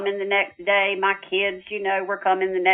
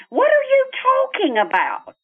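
much higher, startled what-do-you-mean; incredulous protest. Retold or imitated. Daughter protesting her father again, high incredulous pitch. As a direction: push back in disbelief.

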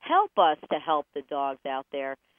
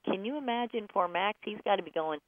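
normal talking voice

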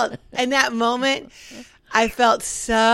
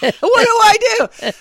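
high, loud, panicked squeal; hammed-up panic, much higher and louder; mock panic; 10 semitones higher, 8 dB louder than usual. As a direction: cry out for help like a frantic colleague. Acting panicked coworker.

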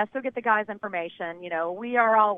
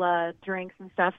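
usual speaking voice

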